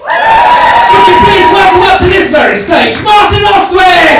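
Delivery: very high, slow, drawn-out shout; big announcer introduction. Real, not acted. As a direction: roar the act onto the stage. Host's big introduction of the speaker.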